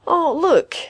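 high-pitched exasperated protest; complaining. A narrator's reading. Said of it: "Caswell complained".

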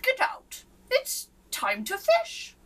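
high, loud, slow urging; eager duke. A narrator's reading. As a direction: urge him out to go fishing. Duke orders king.

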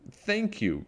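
high-pitched delighted thanks; delighted cry. A narrator's reading. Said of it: "cried Little Witch".

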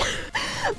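high laugh. Real, not acted. Laughing at her own story.

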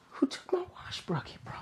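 breathless whisper to himself. Real, not acted. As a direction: whisper it in disbelief. Whispers in disbelief.

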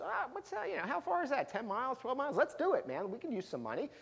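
high, airy, offhand voice for the plucky swimmer; breezy carefree Myrtle. Retold or imitated. Swimmer to her coach, lightly offhand and high. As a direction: toss it off like a long swim is nothing.

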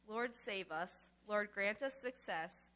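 ordinary delivery

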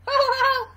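high, loud acted "ho-ho" laugh; gander's laugh. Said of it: "The gander laughed".

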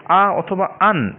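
আ অথবা আন drawn out very slowly, each vowel held open; slow demo of the ফাতহা sound in আ and আন; fatha sound demo. Shown as a demonstration. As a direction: hold the আ and the আন long and clear. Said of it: "এই যে উচ্চারণটা সেটাকে ফাতহা বলে".

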